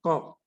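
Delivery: क said as a crisp k sound. Shown as a demonstration. Teacher modelling क as a clean k. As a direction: say क short and hard, like k. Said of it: "इसका उच्चारण क हो जाता है".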